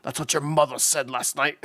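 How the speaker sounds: lightly Scottish Sean Connery zinger; Sean Connery impression. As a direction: deliver the jab as a hammy Sean Connery. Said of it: "that's a horrible impression".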